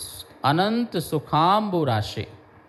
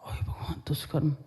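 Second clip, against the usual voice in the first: shocked hushed reply, falling quiet; shocked listener; 7 dB quieter, much faster than usual. Retold or imitated. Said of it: "यदि आज के समय तुमसे बोल दिया जाए". (चौंककर धीमे से फुसफुसाता जवाब)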